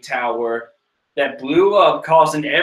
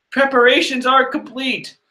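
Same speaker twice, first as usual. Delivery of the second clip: high mock announcement; 9 semitones higher than usual. Voicing crew report.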